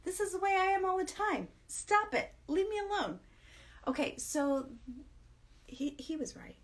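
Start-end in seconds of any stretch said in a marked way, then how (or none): 0.0-3.2 much higher, louder playful whine; wife playfully telling her husband to stop; high mock protest. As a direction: squeal it like a kid shooing him off. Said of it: "And I was like".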